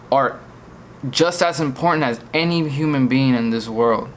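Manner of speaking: usual speaking voice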